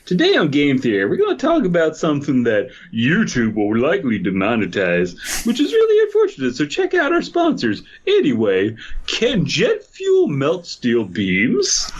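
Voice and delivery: hammed-up YouTuber host voice, jumping high; mock Game Theory intro with a sly twist; MatPat impression. Friend mocks a YouTuber's intro, hammed-up host voice. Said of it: "He's just like".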